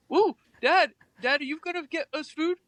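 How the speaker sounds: be the pup begging for dinner; dog's voice. Pretend. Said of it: "share what Hanzo's voice is".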